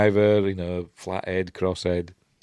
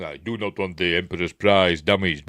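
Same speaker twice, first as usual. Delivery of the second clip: commanding film villain voice; put-on villain voice, louder at the end; villain impression; 4 dB louder than usual. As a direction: give the order as a stern dark lord. Quotes Vader's order.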